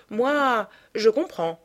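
usual speaking voice

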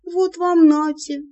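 high, slow, indignant outburst. Acted. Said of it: "возмутилась я".

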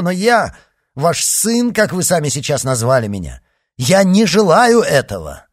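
furious outburst. A narrator's reading. D'Artagnan refusing Athos's plea, furious and loud. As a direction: rage at him, rising to a shout.

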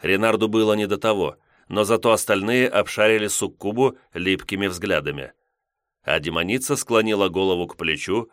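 ordinary delivery, nothing marked

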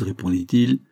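usual speaking voice

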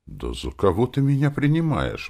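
high-pitched offended question; indignant. A narrator's reading. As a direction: protest like an insulted father. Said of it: "возмутился Иркин папа". (возмущённо, повысив тон)

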